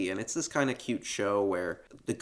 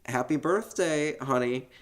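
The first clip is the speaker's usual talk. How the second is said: higher, put-on smiling mom voice; forced-happy mom; 6 semitones higher than usual. Host as mother remembering her kid's birthday, put-on cheerful. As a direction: switch into dutiful mom mode with a smile. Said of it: "I have to be happy now. So she smiles".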